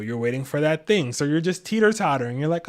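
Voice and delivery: ordinary talk, nothing marked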